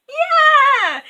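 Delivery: high, loud whoop; happy cheer. A narrator's reading. Narrator cheering the children back to life. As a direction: celebrate the happy ending.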